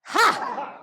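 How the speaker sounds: a short loud Ha! pushed out from the stomach; loud breath-driven Ha!; sharp projected ha. Shown as a demonstration. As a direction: punch out one forceful breath. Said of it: "Breathe in".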